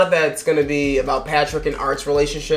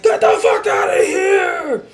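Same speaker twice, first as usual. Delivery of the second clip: much higher, louder hammed-up yell; furious yell; 14 semitones higher, 3 dB louder than usual. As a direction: scream him out of the room. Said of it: "He's like".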